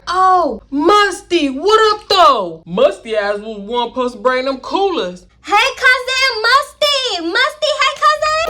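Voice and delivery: high, loud drawn-out calling. Retold or imitated. Relative shouting greetings to cousin Musty, high and drawn out. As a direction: call across the yard, high and stretched.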